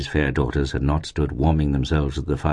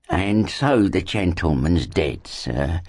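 drawn-out condolence, fading away; Mrs Gamp; much slower than usual. A narrator's reading. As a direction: speak slowly as a mourning-house nurse. Mrs Gamp's character speech begins.

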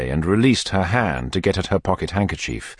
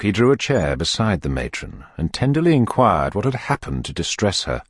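ordinary delivery

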